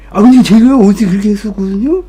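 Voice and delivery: tipsy oversharing rush; overexcited drunk chatter. Retold or imitated. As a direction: gush about his childhood too eagerly. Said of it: "이렇게 시작해".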